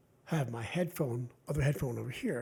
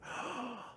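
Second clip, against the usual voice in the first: a quick unvoiced gasp of discovery; sudden surprised gasp; sharp gasp; 8 dB quieter than usual. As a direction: suck in air like you just found it. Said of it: "I went".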